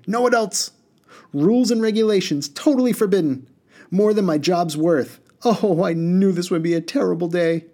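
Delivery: high-pitched, agitated little genie; sharp. A narrator's reading. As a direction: protest sharply, voice pitched right up. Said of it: "said the blue beard sharply".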